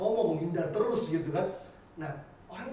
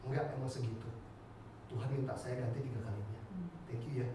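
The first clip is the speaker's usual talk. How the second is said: low, soft and matter-of-fact; calm quiet reply; 7 semitones lower, 9 dB quieter than usual. Retold or imitated. Man on phone confirming God's tripled repayment, quiet and low. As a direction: answer gently, voice dropped.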